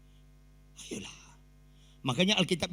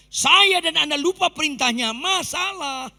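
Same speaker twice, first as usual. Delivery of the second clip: preaching shout; 10 semitones higher, 10 dB louder, slower than usual. Acted.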